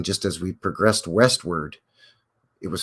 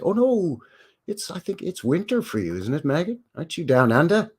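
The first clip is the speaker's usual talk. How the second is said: bad Aussie accent; 8 semitones higher than usual. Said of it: "doing a terrible Aussie accent".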